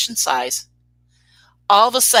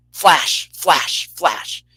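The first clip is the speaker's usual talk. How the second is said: slow, separate flashes, dropping in pitch; deadly serious flashes; 3 semitones lower, much slower than usual. Retold or imitated. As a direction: spell out each flash slowly, in earnest. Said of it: "no joke, you guys, it went".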